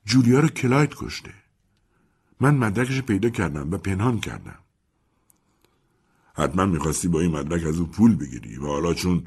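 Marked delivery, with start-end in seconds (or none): 0.1-4.6 confessing Mimi. Acted. Mimi confesses hidden evidence.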